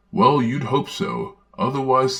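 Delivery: usual speaking voice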